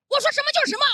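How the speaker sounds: high, forceful mock-fierce outburst; vicious, domineering. Senior grabs flight attendant to assert authority, fierce mock yell. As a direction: lay down the law as an overbearing boss. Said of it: "恶狠狠地说".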